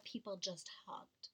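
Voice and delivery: soft unvoiced wish; whisper. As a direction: breathe it out as a hushed whisper. Retold boy's soft wish.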